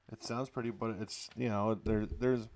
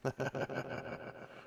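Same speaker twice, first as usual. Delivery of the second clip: soft breathy laugh; 4 semitones higher, 6 dB quieter than usual. Real, not acted. Laughs after bit.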